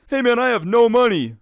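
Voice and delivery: high, loud mocking whine; sarcastic impression of a broke guy; mocking imitation. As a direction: parody the customer pleading poverty. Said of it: "then went".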